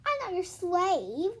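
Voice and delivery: slow, grumpy refusal; a grumpy kid refusing slowly. Acted. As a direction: sulk out each word. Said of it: "grumped Cassie".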